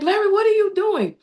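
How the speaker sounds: high, loud exasperated call; calling out in disbelief; surprised. Retold or imitated. Wife calling out at her husband on the floor, exasperated. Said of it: "I'm like".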